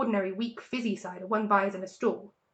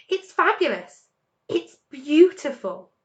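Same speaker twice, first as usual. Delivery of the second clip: rapturous; 6 semitones higher, 6 dB louder than usual. A narrator's reading. Fox savoring cider.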